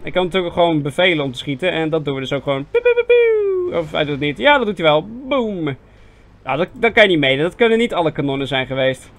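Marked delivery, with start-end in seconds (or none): 2.7-3.8 very high, drawn-out "pew" noises; shooting-noise imitation. Nabootsen van schieten tijdens het spel. 5.3-5.6 single higher, drawn "boom"; cannon-shot imitation. Nabootsen van een kanonschot.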